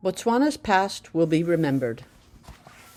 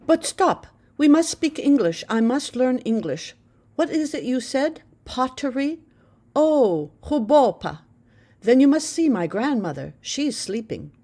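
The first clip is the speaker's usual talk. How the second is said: put-on higher, bright eager girl's voice; eager teenage girl; 7 semitones higher, 4 dB louder than usual. A narrator's reading. Girl insists on speaking English, eager and high. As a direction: rush it, eager to practise English.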